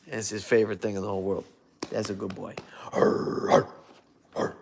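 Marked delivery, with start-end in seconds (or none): 1.9-2.6 dog praise. Real, not acted. 2.9-4.6 a hint of a growl; playful growl. Growling at the dog again.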